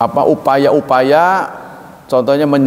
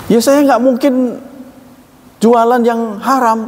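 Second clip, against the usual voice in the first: offended vendor; 9 semitones higher, slower than usual. Retold or imitated. Vendor offended by a halal question, indignant. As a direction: defend yourself as an insulted seller.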